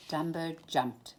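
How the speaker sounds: plain reading voice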